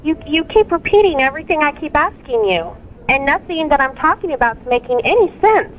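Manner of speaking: ordinary talk, nothing marked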